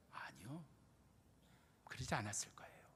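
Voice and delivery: whispered aside to the congregation; breathy secret murmur. Real, not acted. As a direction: drop to a hushed whisper. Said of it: "whispered aside".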